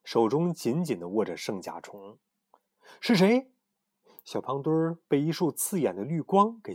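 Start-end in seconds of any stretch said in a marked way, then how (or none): 3.0-3.5 sudden high startled "who's there"; jolted awake, call out high and sharp; high, startled question; startled cry. Acted. Startled awake by green light.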